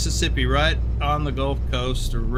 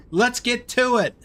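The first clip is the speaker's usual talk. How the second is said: high, bright catchphrase delivery; YouTuber catchphrase; 9 semitones higher than usual. Retold or imitated. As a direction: sing out the channel sign-off. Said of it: "catchphrase of a channel content creator".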